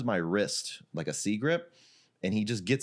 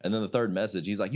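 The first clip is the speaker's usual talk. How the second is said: usual speaking voice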